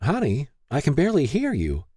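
a concerned mom on the phone; lightly voiced mother.

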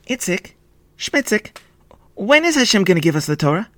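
put-on high-pitched boy's voice; high, urgent child character; eager boy. A narrator's reading. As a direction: call your brothers, then ask eagerly. Boy calls his brothers.

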